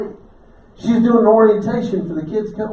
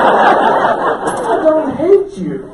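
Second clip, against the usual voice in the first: laughing; 7 semitones higher, 9 dB louder, much slower than usual. Real, not acted. Laughing reassurance after joke.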